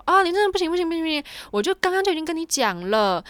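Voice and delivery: high, loud, impatient no-no-no; curt refusal. Retold or imitated. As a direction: imitate the clerk snapping refusals. Clerk refusing rudely, retold.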